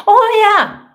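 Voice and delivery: a triumphant high shout; hammed-up cheer; loud high oh yeah.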